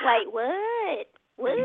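Southern drawl on "Wait, what?"; Southern accent. Shown as a demonstration. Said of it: "Southern, here's the Southern".

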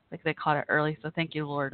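ordinary delivery

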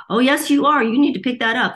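high and loud, pushing hard; bossy inner voice cutting in; insistent inner voice. Retold or imitated. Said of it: "this other voice that's like".

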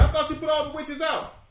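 high, loud burst of mock astonishment; astonished Saul. Acting Saul's surprised reply in retelling.

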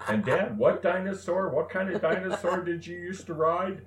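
high, mock-innocent kid question; daughter's teasing voice. Retelling what his daughter asks him, high and teasing. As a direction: tease Dad like a cheeky child. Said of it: "my daughter says".

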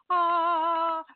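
a mock heavenly ah; angels sighing. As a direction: sing out a dreamy ah like angels. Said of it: "angels go".

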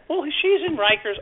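lightly voiced young girl, high and casual; innocent girl. A girl telling the cop her friend is in jail, mild girlish voice. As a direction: play the girl as offhand and innocent.

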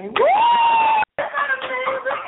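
high, loud cheer, drawn out; thrilled, high-pitched squeal of amazement; excited whoop. Real, not acted. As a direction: shout it in sheer celebration. Celebrates her Broadway audition.

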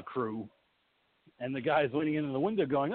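ordinary delivery

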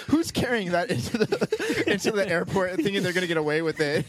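high laughing incredulous quote. Retold or imitated. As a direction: laugh through the disbelief. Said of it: "He's like".

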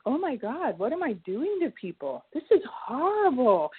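imitating her own shocked outburst; retold horror. Remembering the moment she realized the drugs' harm, a touch of horrified crying. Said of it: "I started to cry".

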